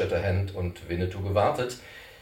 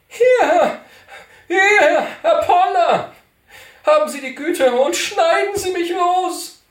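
high piercing shouts, much louder; calling out; 12 dB louder, slower than usual. Acted. Cantor shouting to Poller to cut him free, high-pitched. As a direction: yell to be cut loose. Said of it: "denn jetzt rief er".

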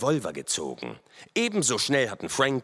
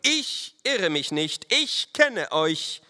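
high, slow, emphatic insistence; insist, drawing out each word high; insistent officer; 9 semitones higher, 3 dB louder, slower than usual. A narrator's reading. Lieutenant insists he knows the riders, stern.

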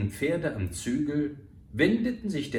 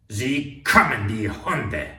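loud and slow, venomous; furious cry; 7 dB louder, slower than usual. A narrator's reading. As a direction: spit out the insult. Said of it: "rief er aus".

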